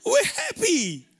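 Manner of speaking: slow, high drawn-out "we're happy"; mock cheerful denial. Preacher mocking forced happiness, slow and high. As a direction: stretch it out like a forced smile. Mocking people who deny sadness.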